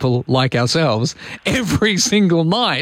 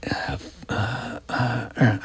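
whispered fumbling; 4 semitones lower, 9 dB quieter, much slower than usual. Real, not acted. Flustered while multitasking.

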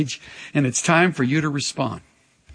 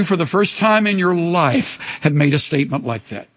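ordinary talk

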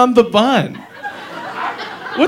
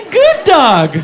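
high doughboy giggle; giggle, then a squeaky line; 11 semitones higher than usual. Acted. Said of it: "he goes".

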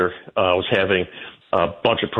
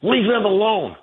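mocking her retort; 10 semitones higher, slower than usual. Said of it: "turns around and says".

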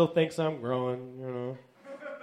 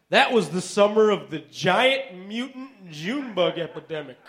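ordinary delivery

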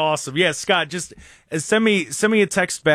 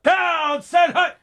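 high-pitched mock quarterback cadence; imitated quarterback snap count; 14 semitones higher, much slower than usual. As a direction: call out the snap like a quarterback overheard mid-play. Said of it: "you can hear the play happening".

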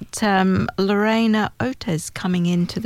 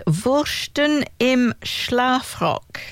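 careful slow German pronunciation; German accent; 2 semitones higher, much slower than usual. Shown as a demonstration. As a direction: say it like a proud German speaker. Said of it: "In my best German accent".

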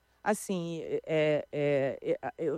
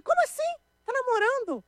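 high squeal of disbelief, much higher; shocked disbelief. Retold or imitated. To her friend, stunned by the news, high shocked squeal. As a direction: gasp it out like you can't believe it. Hearing her stepmother is dating.

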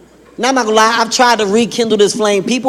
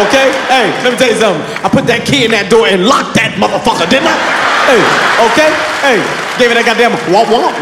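louder, rambling southern grandpa boast; old grandpa voice; 3 dB louder than usual. Retold or imitated. Grandson asks grandpa, he boasts loudly in old man voice. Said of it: "He said".

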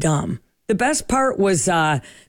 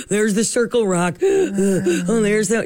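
gasping for air between phrases; mock out of breath; slower than usual. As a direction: act winded from a long bike ride. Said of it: "that you're out of shape".

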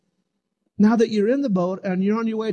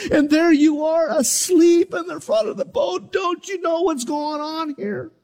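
panicked disciples; 8 semitones higher than usual. Acted. Disciples crying out to a sleeping Jesus in panic. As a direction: plead like men going under in a storm.

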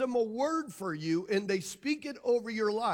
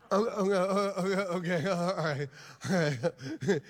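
laughing; 4 semitones lower than usual. Real, not acted. Congregation reaction, pastor laughs.

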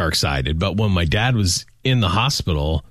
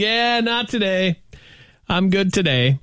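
high, breezy, unbothered; high mock patient; 10 semitones higher than usual. Imitating the hospital patient brushing off death, high. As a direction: toss it off lightly, pitched up. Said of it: "he's like".

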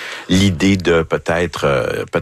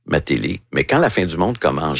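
ordinary talk, nothing marked

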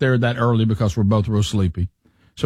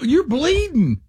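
imitating alarmed wife; 12 semitones higher, slower than usual.

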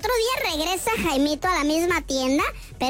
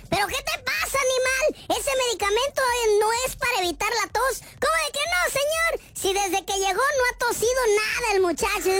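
reclamo agudo y exagerado; indignación actuada; 7 semitones higher than usual. Acted. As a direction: protest in a high, mock-outraged voice. Discusión indignada entre cliente y farmacéutico. (indignado, en tono agudo de reclamo)

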